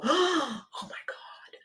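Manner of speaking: breathless whispered oh my God; slow, hushed and amazed; awed whisper. Retold or imitated. Audience reacting to the show.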